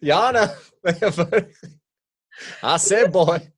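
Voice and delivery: high, loud, hammed-up Southern colonel; Colonel Sanders-like Southern accent. Mocking the actor's accent for laughs, exaggerated Southern accent. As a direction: boom it out as a cartoonish Southern caricature. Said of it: "He sounds like fucking Colonel Sanders".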